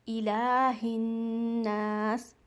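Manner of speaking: slow, drawn-out recitation; Quran recitation. Shown as a demonstration. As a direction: recite slowly with ghunna. Recites the verse.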